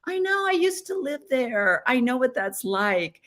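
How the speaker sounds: high, sing-song I know; mock sympathy. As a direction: tease the grandkids gently. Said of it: "it was like".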